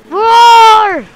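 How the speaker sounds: loud, high, drawn-out roar; big mock-fierce roar; pretend shark roar. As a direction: roar like a playful monster. Roaring as the shark.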